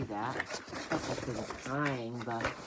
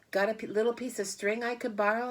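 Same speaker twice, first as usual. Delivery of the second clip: higher, louder, quick pleading pig; eager pig voice; 9 semitones higher, 6 dB louder, faster than usual. Acted. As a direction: rush the request. Wilbur asks Templeton for string.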